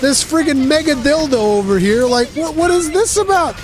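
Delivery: high whiny plea of a wronged beast; pleading monster voice. Pretend. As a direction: whine it out like the innocent victim. Voicing monster pleading with hunter.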